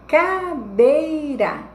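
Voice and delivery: very slow teacher's cadeira; slow syllable demonstration. Shown as a demonstration. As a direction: stretch cadeira into long ca... DEI-ra. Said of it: "a mais forte ficou aqui".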